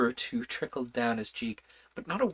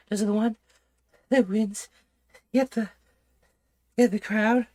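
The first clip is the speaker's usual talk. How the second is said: breathless, high questions; out of breath; 9 semitones higher, 5 dB louder than usual. A narrator's reading. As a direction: ask while winded from running. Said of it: "quite out of breath".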